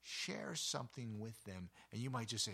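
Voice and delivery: ordinary delivery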